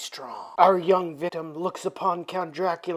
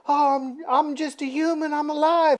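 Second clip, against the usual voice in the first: higher-pitched female character voice; mock innocent plea; 6 semitones higher than usual. Vampire Jeannie trying to fool Frank, mock innocent plea. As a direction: plead innocence in a raised, hesitant voice. Jeannie pleading she is human.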